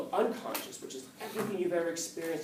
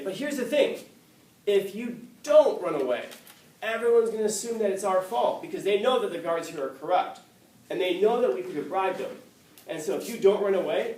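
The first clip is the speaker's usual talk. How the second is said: higher, louder, urgent argument; insistent pleading; 4 semitones higher, 6 dB louder than usual. Retold or imitated. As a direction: press the case as the worried students. Students urgently plead with Socrates.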